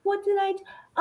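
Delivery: put-on very high sing-songy baby doll voice; sweet childlike sing-song; imitating a sing-songy baby doll voice. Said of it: "sing-songy baby doll voice".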